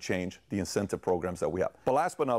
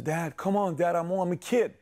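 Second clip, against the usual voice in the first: put-on higher-pitched protest from a kid; pleading son; 8 semitones higher than usual. Son objects to dad's five-dollar demand, pleading. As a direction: plead like a put-upon child.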